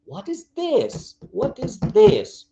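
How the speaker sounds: THIS pitched high and drawn out; emphasis on THIS. Shown as a demonstration. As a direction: slowly point out THIS with a high swoop. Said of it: "changing intonation and changing a bit, uh, the emphasis".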